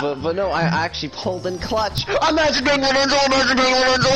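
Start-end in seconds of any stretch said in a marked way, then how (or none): 2.1-4.1 high, louder taunting sing-song; mock the loser gleefully. Real, not acted. Mocking the losing player.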